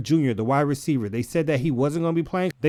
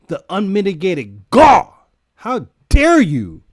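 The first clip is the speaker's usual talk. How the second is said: loud, slow, higher theatrical indignation; mock outrage; 4 semitones higher, 8 dB louder, slower than usual. Real, not acted. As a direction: play up the offense taken. Mock outrage at betting odds.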